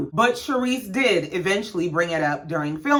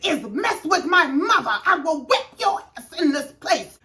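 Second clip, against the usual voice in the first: higher, mock screaming threat; hammed-up higher yell mimicking an angry housewife; imitated scream; 8 semitones higher than usual. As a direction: ham up Karen's screaming threat. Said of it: "So Karen is screaming".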